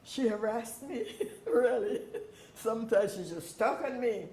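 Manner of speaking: laughing while remembering, pitch up. Real, not acted. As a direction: chuckle through the words. Fond laughing memory of counselor.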